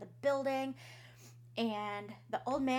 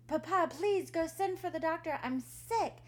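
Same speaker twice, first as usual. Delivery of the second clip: pleading daughter; 6 semitones higher, 4 dB louder than usual. Acted. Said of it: "she said".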